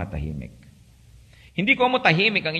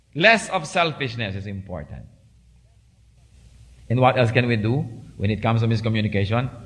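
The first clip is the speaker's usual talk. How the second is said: ordinary talk, nothing marked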